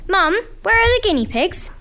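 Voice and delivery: high, singsong call for Mum. Acted. Child calling to Mum, acted.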